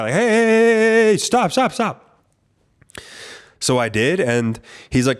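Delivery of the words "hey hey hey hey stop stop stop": high, loud hey hey hey, stop; mock shout. Officer shouting at him from far away to stop. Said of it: "was shouting from far away".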